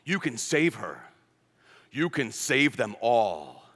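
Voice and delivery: low, slow, solemn father's voice; Superman's dad. Retold or imitated. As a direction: speak as a grave father sending his son off. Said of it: "his dad, looks at him and says".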